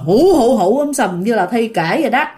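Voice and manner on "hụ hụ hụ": louder mock wail hụ; imitated ghost wailing. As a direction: mimic the ghost's loud crying. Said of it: "Khóc mà khóc mà gọi là um sùm". (giả tiếng khóc hụ hụ)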